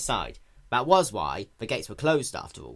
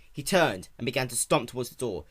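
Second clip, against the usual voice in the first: ordinary delivery, nothing marked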